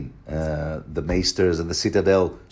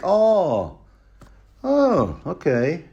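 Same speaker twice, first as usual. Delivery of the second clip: much higher, deflated put-on voice; mock Qyburn; 8 semitones higher, much slower than usual. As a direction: play Qyburn taken aback. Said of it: "Qyburn is a bit like".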